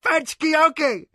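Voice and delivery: high urgent cry; cried out. A narrator's reading. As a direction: shout the order urgently. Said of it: "cried the warrior".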